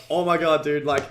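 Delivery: retold exasperation. Business owner reacting to badly translated ads, exasperated. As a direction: exclaim it in retold disbelief.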